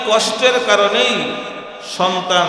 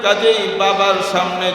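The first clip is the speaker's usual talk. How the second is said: ordinary talk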